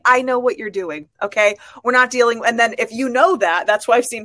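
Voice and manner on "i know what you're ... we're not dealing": retold no-nonsense challenge, slightly raised; firm confrontation. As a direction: call out the manipulation, louder. Retold confrontation with manipulative partner.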